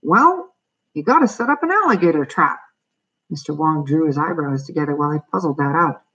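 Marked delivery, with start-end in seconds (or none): 0.0-2.6 high, lilting know-it-all exterminator; singsong high character voice. A narrator's reading. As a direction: offer advice in a raised, swooping voice. Exterminator figure advising the landlord.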